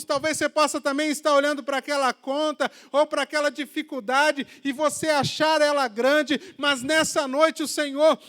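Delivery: loud, high preaching prayer, building in force; full-force Pentecostal-style preaching; impassioned prayer. Acted. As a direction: pray it out with rising fervour.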